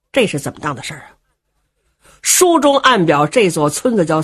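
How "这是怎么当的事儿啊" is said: the warrior mutters the same question to himself, low and soft; puzzled murmur. Acted. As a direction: lower and much softer, musing. Baffled warrior thinking aloud.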